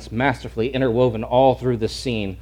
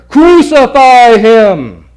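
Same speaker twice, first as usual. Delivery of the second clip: very loud, slow drawn-out chant; shouting louder; 9 semitones higher, 12 dB louder, much slower than usual. Retold or imitated. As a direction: roar it out like a mob. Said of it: "they shouted louder".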